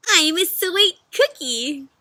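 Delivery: high, slow cookie voice; proud cookie. Acted. Cookie shouting out the bakery door. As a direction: crow it like a tiny sweet cookie.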